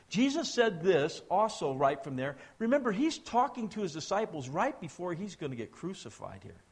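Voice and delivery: ordinary delivery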